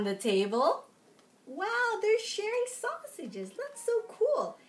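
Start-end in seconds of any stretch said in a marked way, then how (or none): 1.5-4.6 put-on high, delighted wow for the kids; high-pitched excited exclamation. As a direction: marvel at the picture. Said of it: "excited aside to kids".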